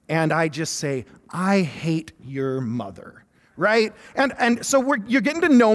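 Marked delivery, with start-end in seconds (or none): 1.3-3.2 slow, low, drawn-out resentment; mock bitter deadpan. Frustrated husband answering his wife about her mother's gift, mock bitter. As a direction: say it slowly and low, each word weighed.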